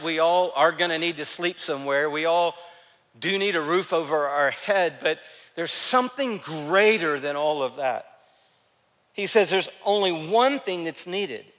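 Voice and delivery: ordinary delivery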